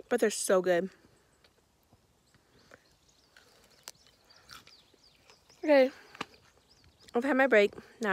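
talking while chewing; words round a mouthful; mouth full. Real, not acted. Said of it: "I'm talking with my mouth full".

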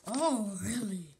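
usual speaking voice

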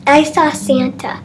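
normal talking voice

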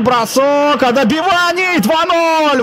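excited goal call. Real, not acted. Goal call in hockey commentary.

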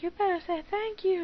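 put-on nagging mom voice; imitating mom. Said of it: "She's like".

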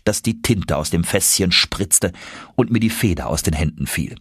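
ordinary delivery, nothing marked

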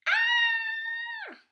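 high, loud acted scream. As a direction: scream like a scared frog in a picture book. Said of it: "He's screaming".

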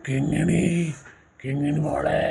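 low, far-off echo of the call; distant echo. Acted. Eerie echo answering the father's call across the desert, low and distant. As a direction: sound like the echo coming back from far away. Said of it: "echo കേട്ട്".

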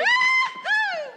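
loud, high cheer; joyful whoop. Acted. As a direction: whoop for joy. Cheers at getting reinstated.

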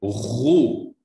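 gargle the Ghoo at the back of the throat; gargled ghoo. Shown as a demonstration. Teacher demonstrating the gargled Ghoo to a student. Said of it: "Dhamma makes an oo sound".

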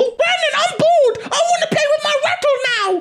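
put-on high crying baby whine; whiny baby voice. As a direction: whine for a toy like a toddler. Baby demands rattle.

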